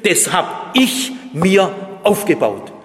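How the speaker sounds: loud, high-pitched boasting as the proud ego; a smug self-made man shouting his life's work; self-righteous boast. Acted. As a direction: crow about your own achievements, full volume. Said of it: "das selbstherrlich ist und das sagt".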